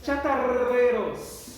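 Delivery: "Chatarreros" drawn out slowly with a long rolled rr; slow word model. Shown as a demonstration. Teacher models a new word. ("Chatarreros" devagar, com o rr bem vibrado)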